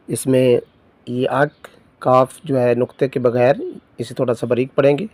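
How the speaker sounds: ordinary talk, nothing marked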